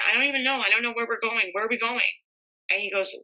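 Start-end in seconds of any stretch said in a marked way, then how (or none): 0.0-2.0 higher-pitched, mildly distraught retelling; retold crying. Woman retelling her answer to the cop as she cried, high and distressed. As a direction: imitate a helpless, tearful reply, pitched up. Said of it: "I'm like, I'm crying".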